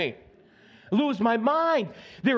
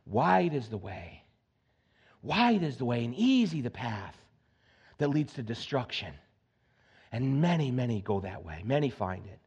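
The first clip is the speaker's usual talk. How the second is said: hushed scripture reading; 10 semitones lower, 5 dB quieter than usual. Acted. Preacher quoting Jesus to the church, low and hushed. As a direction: drop down and lay the verse out gently.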